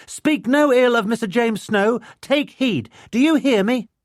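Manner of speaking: put-on very high, loud childlike proclamation; declaiming. A narrator's reading. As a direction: command the grown-up like a tiny queen. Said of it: "she declaimed".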